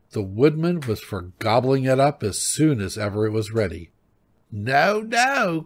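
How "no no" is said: high, drawn-out cry of refusal; scolding old wife, pitched high; greedy old wife. A narrator's reading. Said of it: "cried the greedy old wife".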